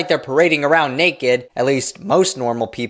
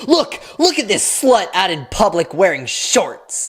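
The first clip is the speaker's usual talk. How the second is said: put-on higher, scandalized old preacher voice; mock preacher to wife; 5 semitones higher than usual. Preacher showing his wife photos, in mock scandalized voice. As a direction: sneer it like a prudish old man pointing at photos.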